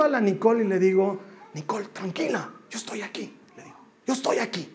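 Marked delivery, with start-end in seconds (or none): 1.5-4.7 much softer, tender comfort; calm a frightened child; father soothing his scared daughter, softly; soft reassurance. Retold or imitated.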